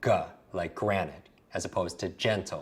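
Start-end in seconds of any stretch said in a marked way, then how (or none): 0.0-0.1 "g" sounded out as guh; hard G. Shown as a demonstration. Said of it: "you have the hard G".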